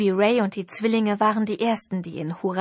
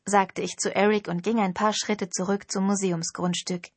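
ordinary delivery, nothing marked

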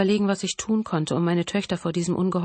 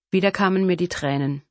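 ordinary delivery, nothing marked

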